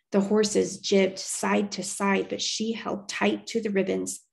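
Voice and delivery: plain reading voice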